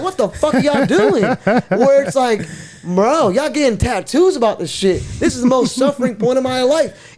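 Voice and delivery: Jesus voiced through laughter; laughing mock Jesus. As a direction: giggle through the quote. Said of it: "You'd be like".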